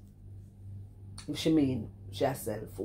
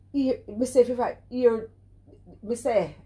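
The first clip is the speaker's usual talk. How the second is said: worked-up outburst; 7 semitones higher, 5 dB louder than usual. Real, not acted. Worked-up rant at Curly.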